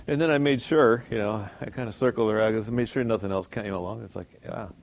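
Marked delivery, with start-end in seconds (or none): none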